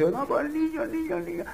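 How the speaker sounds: high, soft lament repeating el niño; mock mourning cry, high and soft; grieving parent's wail. People grieving a dead child.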